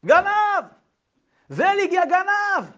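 high, loud furious yell of thief; shouting thief. Acted. Shmerl shouting at Zelig over the stolen rooster, loud furious yell. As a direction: scream it at the thief across the crowd. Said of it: "שמרל מתחיל לצעוק".

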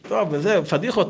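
ordinary delivery, nothing marked

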